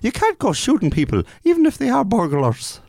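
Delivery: very high, hammed-up bad Irish accent; terrible Irish accent. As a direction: imitate an actress failing at Irish. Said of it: "terrible Irish accent".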